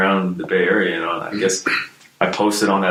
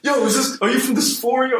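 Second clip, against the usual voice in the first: imitating pissed-off guy. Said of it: "He sounded pissed off as shit".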